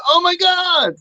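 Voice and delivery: high-pitched mock gushing; imitated amazement. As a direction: squeal it like a thrilled admirer. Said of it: "they thought it was amazing".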